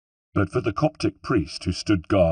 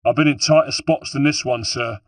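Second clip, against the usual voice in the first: grunted bravado, raised voice; loud, higher, cocky soldier's reply; false bravado; 5 semitones higher, 4 dB louder than usual. A narrator's reading. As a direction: put on a brave front mid-fight. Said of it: "hear the false bravado in his voice".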